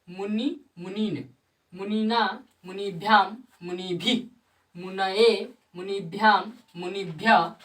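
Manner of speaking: each declension form recited slowly and distinctly, with a pause after every word; sanskrit recitation. Shown as a demonstration. Teacher recites the declension of मुनि for students, clear and measured. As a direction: chant the forms of मुनि one by one for the class. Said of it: "चलिए इसको हम पढ़ के बता देते हैं".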